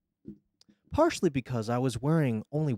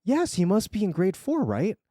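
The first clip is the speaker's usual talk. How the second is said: higher, louder and perked up; brightened; 7 semitones higher, 4 dB louder than usual. A narrator's reading. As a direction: chirp it like a pleased older neighbor. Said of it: "She brightened".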